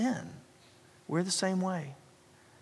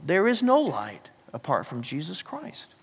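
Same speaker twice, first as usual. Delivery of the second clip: ordinary delivery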